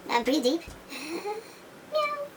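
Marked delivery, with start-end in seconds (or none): 1.9-2.3 squeaky high-pitched meow; a tiny cat's meow, pitched way up. Pretend. Cat patient breathes for doctor.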